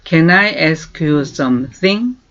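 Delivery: very slow, clear English for learners to copy; slow model phrase. Shown as a demonstration. Teacher slowly modelling a question. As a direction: stretch each word for repeating. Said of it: "다시 한번".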